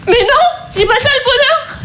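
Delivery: hysterical laughing rant. Acted. Tired mother lecturing her carefree friend about happiness, laughing hysterically. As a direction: build from a scoff to an unhinged laugh.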